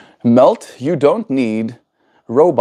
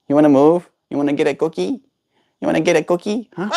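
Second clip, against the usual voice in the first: higher sing-song questions; pet baby talk; 6 semitones higher, much faster than usual. Acted. To the bird that wants to move, higher coaxing baby talk. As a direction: coax the bird off its perch.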